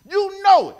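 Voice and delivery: loud, emphatic declaration; preaching shout. Real, not acted. Pastor driving the point home to the church, loud.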